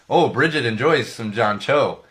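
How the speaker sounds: ordinary talk, nothing marked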